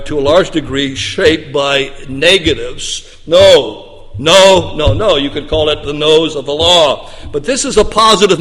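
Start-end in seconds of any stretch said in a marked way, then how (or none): 3.3-5.2 emphatic repeated no. Shown as a demonstration. Listing the law's negatives.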